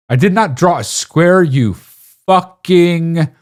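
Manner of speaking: high, loud outburst stretching the last word; exasperated yell. Real, not acted. Berating Mitty for seeing a square, loud and exasperated. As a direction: yell in indignation, dragging out the curse. Rants at Mitty's wrong guess.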